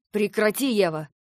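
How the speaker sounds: loud, commanding stop; sharp command. Acted. Husband orders his struggling wife to stop, loud. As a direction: snap at her to stop.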